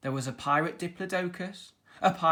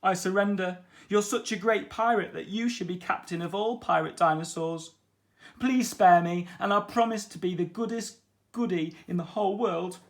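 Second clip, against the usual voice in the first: louder, higher comic begging; pleading beaten dinosaur; 4 semitones higher, 5 dB louder than usual. A narrator's reading. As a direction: beg like a defeated bully. Beaten dinosaur begs for mercy.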